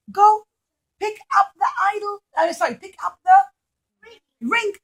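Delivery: high, loud, quick king voice; scheming king. Acted. King ordering the little boy, high and loud. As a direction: order him with false sweetness.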